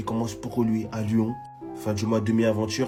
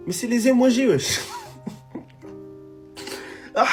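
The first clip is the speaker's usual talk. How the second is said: excited; 13 semitones higher than usual. Real, not acted. Excited laugh at emojis.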